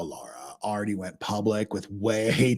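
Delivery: ordinary talk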